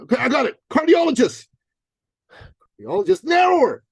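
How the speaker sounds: put-on high, naive young guy; eager trainer voice. Dan telling his coach about doctors, high naive voice. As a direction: voice him high and earnest. Dan proud of new niche.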